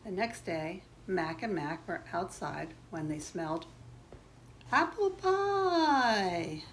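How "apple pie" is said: slow, high drawn-out swoon; rapturous craving. A narrator's reading. Two boys smell pie outside, long high rapturous call. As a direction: hold the last word long and let it slide down.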